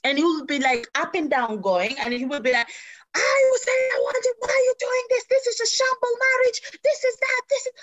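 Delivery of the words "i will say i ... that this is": much higher, whiny, crying mock tantrum; husband's tantrum. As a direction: whine like a grown man throwing a toddler fit. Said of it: "this man will be acting similar to that".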